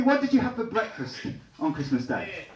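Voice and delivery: ordinary talk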